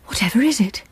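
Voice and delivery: anxious whispered question. Acted. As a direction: whisper it, alarmed. Startled by figure at door.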